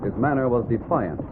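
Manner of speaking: ordinary delivery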